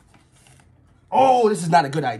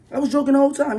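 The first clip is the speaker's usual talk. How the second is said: defensive higher repeat; louder, higher insisting; 7 semitones higher, faster than usual. Real, not acted. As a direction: raise the voice on I was joking the whole time, stress whole.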